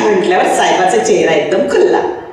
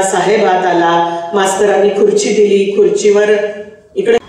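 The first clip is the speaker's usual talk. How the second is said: ordinary delivery, nothing marked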